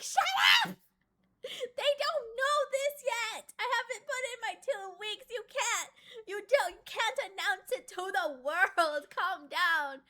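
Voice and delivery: high, loud, hammed-up outburst; panicked scolding. Employee silencing coworker before others hear, hammed-up panic. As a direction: shriek at a blabbing friend to shut up.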